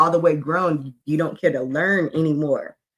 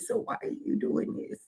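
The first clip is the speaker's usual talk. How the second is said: whisper; 12 dB quieter than usual. Retold or imitated. Retold question to daughter.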